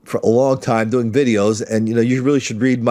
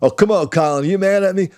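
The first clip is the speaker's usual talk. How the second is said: pleading host voice; 7 semitones higher than usual. Retold or imitated. Host pleading in retold exchange.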